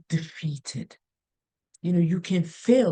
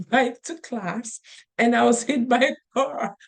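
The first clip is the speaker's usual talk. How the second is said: usual speaking voice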